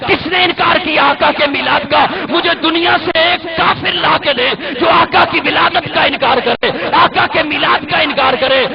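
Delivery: full-force pulpit challenge; fiery preaching. Acted. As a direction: thunder it at the congregation. Preaching climax.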